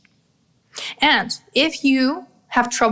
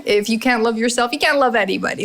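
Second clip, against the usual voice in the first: normal talking voice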